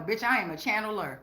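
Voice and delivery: normal talking voice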